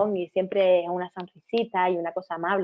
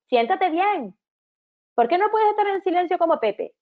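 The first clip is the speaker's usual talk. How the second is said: a bossy teacher firing off orders, pitched higher; higher, bossy classroom orders; 6 semitones higher than usual. Acted. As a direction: snap out commands at a pupil. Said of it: "En vez de".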